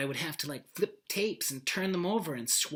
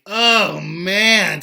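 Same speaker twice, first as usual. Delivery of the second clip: loud exclamation; 4 semitones higher, 15 dB louder than usual. Real, not acted. Overwhelmed exclamation.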